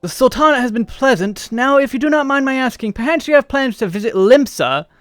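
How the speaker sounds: put-on light British accent. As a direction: voice the courtier with a touch of British. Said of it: "Do I sound British?".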